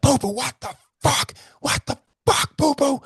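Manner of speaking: very high strained shout at the dog; angry yell. Retold or imitated. Shouting at dog Poo Poo who jumped off the bike, furious. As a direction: scream at the dog in fury. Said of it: "I have a real anger of moment".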